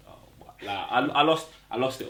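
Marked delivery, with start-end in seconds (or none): 0.1-0.5 breathless whisper of shock; stunned whisper at the screen; whispered disbelief. Retold or imitated.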